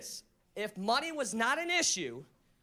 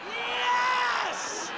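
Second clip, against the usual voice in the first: high, loud shouted yes; triumphant yes; 12 semitones higher, 4 dB louder than usual. Retold or imitated. Comic cheering the pothead's answer, loud. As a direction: cheer like the answer won everything. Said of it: "I was like".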